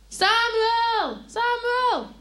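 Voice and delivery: high, loud call from afar. A narrator's reading. Unseen voice calls the boy a third time, loud. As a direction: ring the name out. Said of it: "hoort Samuel weer roepen".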